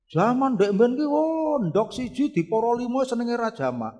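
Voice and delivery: mock parental lecture about the old days; nagging parent. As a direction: reminisce in a high, complaining parent's voice.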